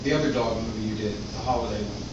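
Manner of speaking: normal talking voice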